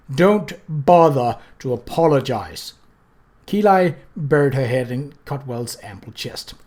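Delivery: loom over them with every word; Death, cold and menacing. Acted. Death's voice, slow and heavy.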